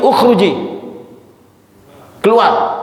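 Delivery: ukhruji barked out high and loud; rough eviction order, pitched high; harsh command. Shown as a demonstration. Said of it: "Intonasinya udah beda".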